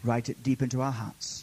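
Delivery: low, hushed closing prayer; reverent, subdued petition. Real, not acted. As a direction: speak quietly to God.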